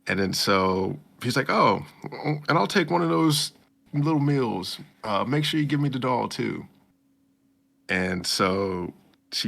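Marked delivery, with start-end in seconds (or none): 1.5-6.7 cheerful boss. Retold or imitated. Boss voiced ordering.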